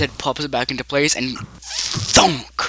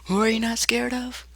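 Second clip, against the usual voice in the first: high, eerie character voice; high-pitched, floating question; Puppet; 10 semitones higher, 3 dB quieter than usual. Acted. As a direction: ask it high and airy. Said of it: "Puppet says".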